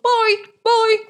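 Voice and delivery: very high, loud sing-song bye bye; a bright, cheery high-pitched wave-off; chirpy goodbye. Acted. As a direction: squeak the farewell way up high. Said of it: "Toriel was like".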